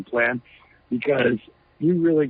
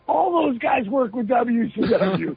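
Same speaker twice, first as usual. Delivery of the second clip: higher, slow, with disbelief; incredulous; 11 semitones higher, slower than usual. Real, not acted. Talking about former WCW colleagues, incredulous and higher. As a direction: spell out the irony slowly, pitched up. Laughing at rivals' hypocrisy.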